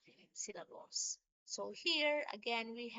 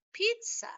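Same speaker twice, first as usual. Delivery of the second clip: "pizza" with "piz" high and loud; trochaic stress; 11 semitones higher, 7 dB louder than usual. Shown as a demonstration. Teacher giving a trochee example, stress on the first syllable of "pizza". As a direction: punch the first syllable of "pizza", let the second fall. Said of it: "stressed syllable in the first".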